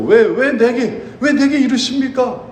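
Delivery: mock-anguished complaint, not fully wept; anguished why me. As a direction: beg God why, voice lifted. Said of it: "Why me?"라는 용어가 있어요".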